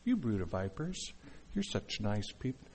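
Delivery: low, soft, harmless insult; gently ironic, quiet niceness; sweet, mild mock delivery. Shown as a demonstration. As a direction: say it as if to nice people. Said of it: "that's not the way he said it".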